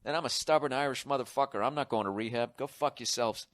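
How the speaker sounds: ordinary talk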